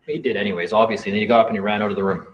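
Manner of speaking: ordinary talk, nothing marked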